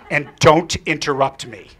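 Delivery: slow, loud command; stern order. Retold or imitated. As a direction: lay down the rule firmly. Girl gives a stern order.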